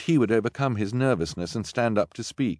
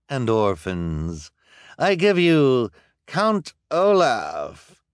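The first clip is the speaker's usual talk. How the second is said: slow, high grand announcement; officer voice; 5 semitones higher, 5 dB louder, much slower than usual. Acted. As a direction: present the prisoner with pomp. Officer presents the prisoner.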